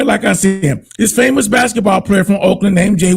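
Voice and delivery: ordinary talk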